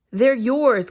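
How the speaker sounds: loud call to the squirrels. A narrator's reading. Woodpecker calling to the squirrel, loud. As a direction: call it out for all to hear. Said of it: "called the woodpecker".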